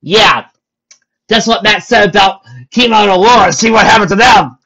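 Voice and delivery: high, loud comic comeback; loud retort. Acted. Character answering the shouting author, loud high retort. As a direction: argue back at full volume. Rainbow argues back loudly.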